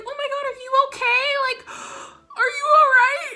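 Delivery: high, fast, gasping mock concern; worried onlookers. Imitating worried classmates fussing over her, high and gasping. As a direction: play everyone fussing over her with a gasp. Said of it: "And everyone's like".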